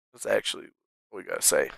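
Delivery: breathy whisper, almost unvoiced; whispered aside. Real, not acted. Streamer whispering an aside to chat, hushed. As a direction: drop to a whisper for the last words.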